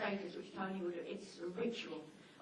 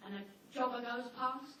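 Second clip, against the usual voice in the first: ordinary delivery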